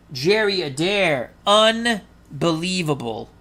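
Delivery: emphatic, drawn-out announcer's call; excited play-by-play. Pretend. Announcer recaps the runner thrown out stealing, emphatic play-by-play. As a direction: punch each phrase, stretching the key words.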